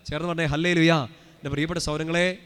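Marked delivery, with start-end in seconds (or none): 0.4-0.9 loud congregational hallelujah; rallying call. Real, not acted. Preacher urging the congregation to say it together, loud. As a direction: call it out loudly for all to join.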